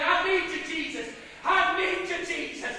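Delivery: loud plea building to a raw, strained scream; desperate prayer shouted at full force; crying out to Jesus. Acted. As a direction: cry it out harder each time, until the voice gives way. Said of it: "waiting for you to cry".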